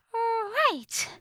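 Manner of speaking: very high, drawn-out fairy voice; Tattle. A narrator's reading. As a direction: answer doubtfully in a tiny pitch. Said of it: "Tattle said".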